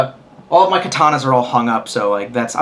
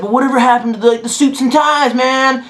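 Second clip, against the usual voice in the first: a lightly voiced bar buddy, noticeably higher; casually teasing, pitched up; 9 semitones higher than usual. Philadelphia bar buddy asking about the suits, pitched up. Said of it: "and he said".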